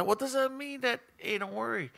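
normal talking voice

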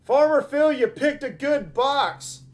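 high, loud shout-out; excited congratulations. Real, not acted. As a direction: call it out like a winner. Announces a viewer's winning box.

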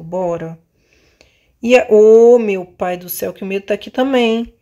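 usual speaking voice

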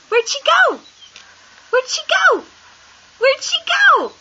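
high squeal swooping down at the end; playful baby talk. Pretend. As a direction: coo it very high to amuse a toddler. Playful peekaboo-like game with toddler.

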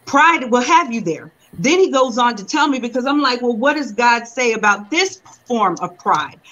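ordinary talk

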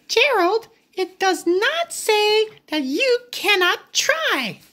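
high little pig voice; Piggy cheering on her friend, high little voice. Acted. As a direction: pitch way up for the eager pig. Reading Piggy's line to Gerald.

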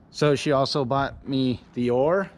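ordinary talk, nothing marked

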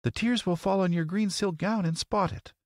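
put-on very high girlish voice; green girl. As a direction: warn in a high-pitched girl's voice. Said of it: "cried the green girl".

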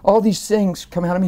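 ordinary talk, nothing marked

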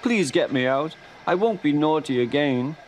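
pleading. A narrator's reading. Stuck Thomas begs the controller.

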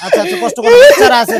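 high, loud urgent coaxing; urgent plea. Real, not acted. Begging the weeping father for the children's sake, high and loud. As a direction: plead with him to stop. Pleading over crying.

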